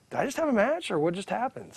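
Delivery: high, quiet bewilderment; retold dazed confusion; deer in headlights. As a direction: ask softly, completely lost. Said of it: "deer in the headlights".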